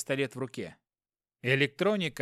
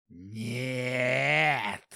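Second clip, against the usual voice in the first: drawn out. Acted. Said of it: "протянул Хопкин".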